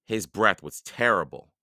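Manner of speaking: usual speaking voice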